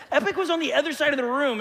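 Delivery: real laughter through fast words; laughing while telling. Real, not acted. Father chuckling over his son's milk excuse, laughing fast. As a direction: laugh out the line, amused at the excuse.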